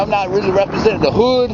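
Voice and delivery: usual speaking voice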